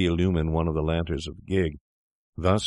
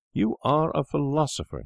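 cried out; 9 semitones higher than usual. A narrator's reading. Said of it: "he cried".

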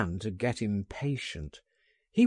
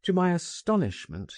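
usual speaking voice

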